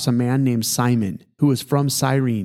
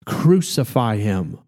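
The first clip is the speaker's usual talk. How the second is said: plain reading voice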